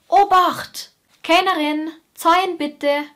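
loud, high Bavarian warning call; shouted Bavarian accent. Retold or imitated. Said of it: "deshalb kann ich das auch ganz gut imitieren".

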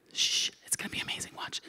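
hushed conspiratorial whisper, a bit quick. Retold or imitated. Planning the niece's Instagram surprise, whispered. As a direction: whisper it like a secret plan. Secret plan for niece's photo.

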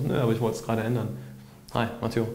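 quick, quiet offhand comeback; casual. Shown as a demonstration. Replying to a stranger who asks if they know each other, casual. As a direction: toss the answer away lightly. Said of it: "in diesem Tonfall".

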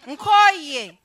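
fed-up outburst. Acted. Wife to husband, fed up, in a loud outburst. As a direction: burst out loud, sick of it all.

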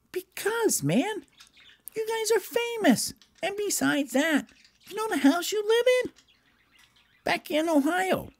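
very high, chatty elf; high cartoon elf; Gerald. Elf explains their fame.

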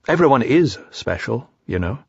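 plain reading voice

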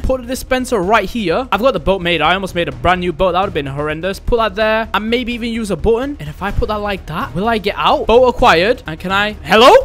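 ordinary delivery

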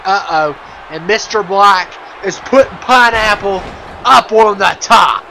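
ordinary talk, nothing marked

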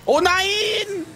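high, stretched-out cry of dismay. Real, not acted. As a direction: wail it high as the goal slips away. Gegentor fällt. (hoher, lang gezogener Entsetzensruf)

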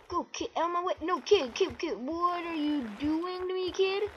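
high, strung-out pleading; exasperated pleading. Real, not acted. Player begs the kid in the game to move, exasperated pleading.

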